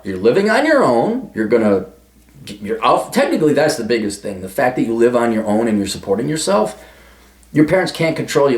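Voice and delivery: ordinary talk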